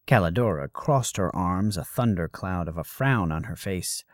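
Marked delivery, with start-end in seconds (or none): none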